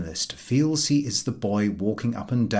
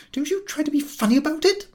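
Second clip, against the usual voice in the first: angry warning; faster than usual. Acted. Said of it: "said the lady".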